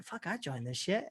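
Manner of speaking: a quiet aside, half-joking that he's hooked; mock resigned aside. Host's mock reaction to cult recruitment.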